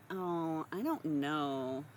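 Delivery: high, prissy, hesitant then scolding; a lightly voiced sanctimonious bystander; coy dithering that turns to a prim tut; mock demure voice. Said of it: "just going".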